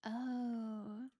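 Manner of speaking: ordinary delivery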